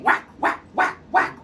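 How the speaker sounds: unvoiced whack whack sounds; whack sound effect. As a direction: whisper the stick's hits. Stick beating sound.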